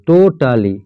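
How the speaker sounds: Totally slowly, as টো, টা, লি; syllable by syllable. Shown as a demonstration. Teacher finds three syllables here, totally split as টো-টা-লি. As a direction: give totally three distinct beats. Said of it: "এখানে তিনটে".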